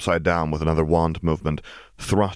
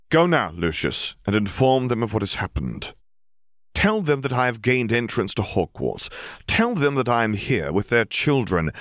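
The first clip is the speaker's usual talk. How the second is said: higher, measured decree; commanding dark lord; 6 semitones higher than usual. Acted. Riddle ordering Lucius to carry his message, cold command. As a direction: give orders with cold authority.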